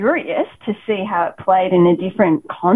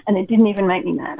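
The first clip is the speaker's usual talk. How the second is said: ordinary talk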